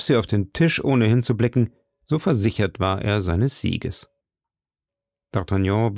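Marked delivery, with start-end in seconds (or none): none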